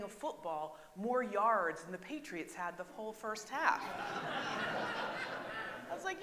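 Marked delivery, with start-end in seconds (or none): 3.9-5.9 breathy high real laugh at the joke; amused unvoiced giggle. Real, not acted. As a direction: let a real laugh escape, high and airy. Laughing at Josh Johnson's joke.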